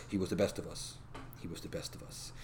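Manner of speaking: low, hushed eulogy; Henry Cavill. Retold or imitated. Superman mourning at Bruce Wayne's grave, low and solemn. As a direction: voice a grieving hero, fading almost to a whisper.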